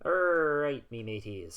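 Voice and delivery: loud, drawn-out pirate growl on the first word; hammed-up pirate greeting to the crew; bad pirate accent. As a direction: put on a cheesy pirate accent. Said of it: "that was a really bad accent".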